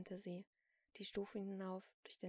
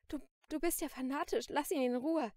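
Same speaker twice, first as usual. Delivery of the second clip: stammered, raised, loud outburst; agitated protest; 7 semitones higher, 12 dB louder than usual. A narrator's reading. Shopkeeper defends the artist, agitated and loud. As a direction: protest high and loud, stumbling at the start.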